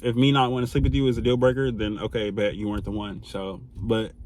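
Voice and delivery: normal talking voice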